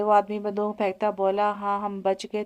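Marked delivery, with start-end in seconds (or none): none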